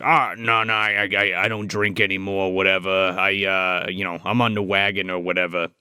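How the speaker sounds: low, subdued voice of a man declining; mild low demurral. Retold or imitated. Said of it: "he's basically like".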